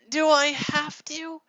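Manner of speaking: high, loud, drawn-out complaint; whiny protest. Acted. Sarah didn't like this, whining in protest. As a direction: drag it out as a reluctant whine. Sister objects to the chore.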